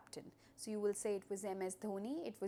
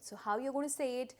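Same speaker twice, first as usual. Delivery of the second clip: ordinary talk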